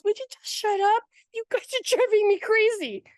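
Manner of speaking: very high, fed-up mock outburst; mock exasperation. Friend telling the movie-watching angels off, mock exasperated. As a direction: imitate a friend at the end of her rope, pitch way up.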